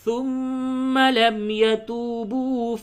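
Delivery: slow, high, drawn-out melodic chant; Quran recitation. Shown as a demonstration. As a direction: chant the full verse slowly with heavy lam in Allah.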